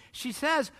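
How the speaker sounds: normal talking voice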